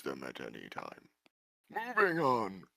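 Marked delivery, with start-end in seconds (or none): 1.7-2.6 brisk high guide voice; chirpy NPC moving the tour along. Acted.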